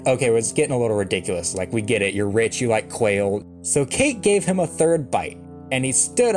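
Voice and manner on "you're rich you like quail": ordinary delivery, nothing marked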